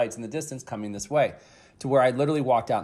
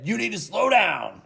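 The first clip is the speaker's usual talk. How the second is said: loud, higher firm command; emphatic and assertive; 8 semitones higher, 5 dB louder than usual. Retold or imitated. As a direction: lay down the law to the driver. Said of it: "very emphatically and with a great deal of assertiveness said".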